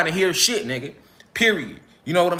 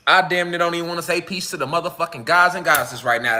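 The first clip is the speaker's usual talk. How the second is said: usual speaking voice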